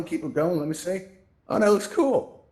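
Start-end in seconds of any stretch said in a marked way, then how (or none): 1.5-2.4 high, louder cry of delight; genuinely impressed; excited. Real, not acted. Host reacting to the crowd's whirlpool, excited.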